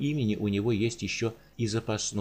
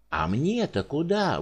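wailing cry; 7 semitones higher, slower than usual. Acted. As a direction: cry out in dismay. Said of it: "возопил Прокоп".

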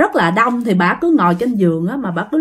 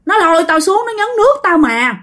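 higher-pitched, emphatic retold testimony; pleading insistence in a raised pitch; insisting; 6 semitones higher than usual. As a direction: insist on what happened to you, voice pitched up. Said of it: "bà Út khẳng định rằng á là".

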